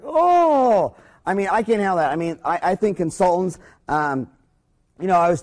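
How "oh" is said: sharp high exclamation, louder; a gasp of disbelief; shocked oh. Retold or imitated. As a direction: blurt out a stunned oh. Reacting to friend's complaint.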